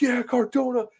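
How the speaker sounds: high, excited fan cheer. Retold or imitated. Fan greeting the wrestler, excited cheer. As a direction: greet your hero with a cheer. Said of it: "I'm like".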